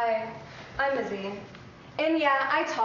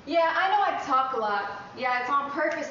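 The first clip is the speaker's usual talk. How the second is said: ordinary talk, nothing marked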